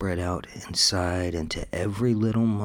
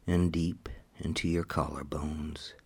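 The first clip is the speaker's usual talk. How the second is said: whisper; 4 dB quieter than usual. Acted. Whispered hypnosis cue.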